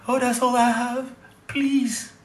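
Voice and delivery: high, whiny mock plea; hammed-up pleading promoter. Promoter begging the comedian for mercy, high mock whine. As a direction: beg like a crook putting it on.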